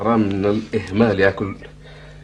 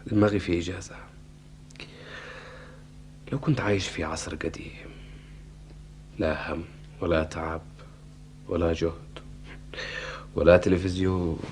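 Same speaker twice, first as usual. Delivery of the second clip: dreamy murmur; 5 semitones lower, 10 dB quieter than usual. Acted. Dreamy wish about olden days.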